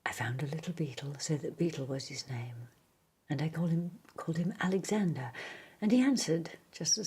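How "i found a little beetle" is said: plain reading voice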